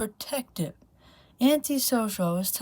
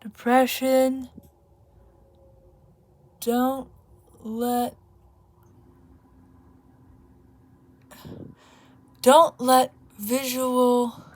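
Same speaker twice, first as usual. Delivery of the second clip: slow halting read; 4 semitones higher, much slower than usual. Real, not acted. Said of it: "This next one is a message to depression".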